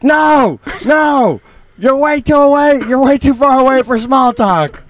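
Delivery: high, strained holler of refusal; imitated far-off shouting. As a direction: call back across a hundred yards, mock-exasperated. Imitates shouting back across the yard.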